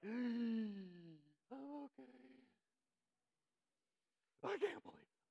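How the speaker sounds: hammed-up bottled-up upset; knotted-up denial. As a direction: act out pretending to be fine with a knotted stomach. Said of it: "there's a knot in your stomach".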